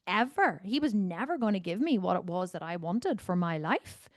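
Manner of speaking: ordinary talk, nothing marked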